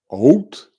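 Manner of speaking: "out" high and loud, vowel rounded toward "oat"; trying out pronunciations. Shown as a demonstration. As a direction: push "out" up as an odd variant. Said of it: "how many different ways you could pronounce".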